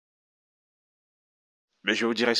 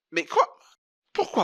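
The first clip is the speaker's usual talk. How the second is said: shocked; 10 semitones higher, 4 dB louder than usual. Acted. Pastor shocked by the story.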